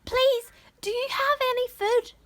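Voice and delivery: put-on high, loud boyish plea; shouting up. A narrator's reading. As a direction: plead loudly to someone far above. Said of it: "Jack shouted up".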